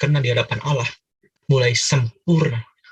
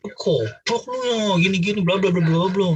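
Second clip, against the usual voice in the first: mock Batak cursing; 5 semitones higher, slower than usual. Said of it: "orang Batak kan kalau udah ngomong kotor".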